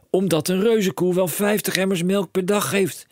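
high and loud, racing with plans; a dad who sees a fortune; excited cry. A narrator's reading. Father telling his son of riches, high excited call. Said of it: "riep meneer Krakeel".

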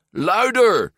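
high, loud order; commanding. A narrator's reading. Alberto coaching his timid friend, commanding. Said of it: "beval Alberto".

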